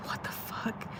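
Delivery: hushed, voiceless disbelief; stunned whisper. Retold or imitated. Retelling seeing another cat come up, stunned whisper. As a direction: whisper it as a retold reaction.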